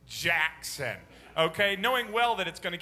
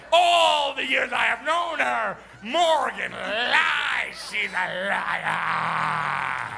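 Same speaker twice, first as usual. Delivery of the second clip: slow loud tattling shout; a boy insisting his sister lies; accusing child; 6 dB louder, much slower than usual. Retold or imitated. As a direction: drag out every word blaming his sister. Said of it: "he goes".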